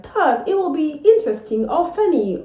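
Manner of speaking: usual speaking voice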